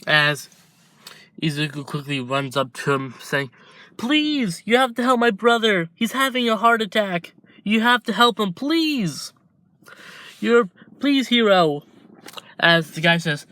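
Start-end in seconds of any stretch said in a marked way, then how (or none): 4.0-11.8 put-on high, pleading little-boy voice; pleading child. Izuku begging the hero to save his brother, high pleading child. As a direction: beg like a desperate small child.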